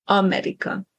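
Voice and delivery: America with the opening A stressed, Hungarian style; stress on first syllable. Shown as a demonstration. Teacher repeats the Hungarian example, stress on first syllable. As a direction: hit the A of America, then clear short syllables. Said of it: "stress on the first syllable".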